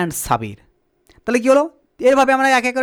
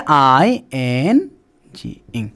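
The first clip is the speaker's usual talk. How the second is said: slow dictation; 10 semitones lower, much slower than usual. Shown as a demonstration. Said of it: "teacher dictating the suffix".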